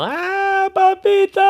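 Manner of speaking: put-on very high, squealing child's wail; high tearful papito; crying child calling. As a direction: wail it like a toddler crying for daddy. Younger son crying in the night.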